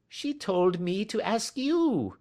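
very high, slow plaintive protest; little man's pleading whine; whining. A narrator's reading. Little man protesting to the angry salesman, whining.

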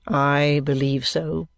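low, slow monotone assent; dry, subdued lawyer. Acted. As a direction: echo it low and level. Vholes echoes Kenge's answer.

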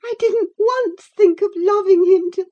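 put-on high, tearful girlish voice; sobbing protest. A narrator's reading. Cathy denying she loved him, sobbing. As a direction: protest through sobs, high and loud. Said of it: "sobbed Cathy".

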